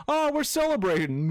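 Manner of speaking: high, whooping mock excitement; mock celebrating. Imitates man grabbing celebratory kiss.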